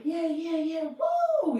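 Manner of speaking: high, loud whooping; mock partying whoop; party cheer. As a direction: hype it up like a club night. Partying whirlwind.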